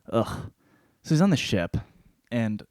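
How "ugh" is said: usual speaking voice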